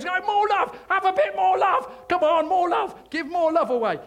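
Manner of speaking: loud, high-pitched evangelist impression; J John. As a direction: urge the crowd on at full volume. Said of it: "He speaks like this".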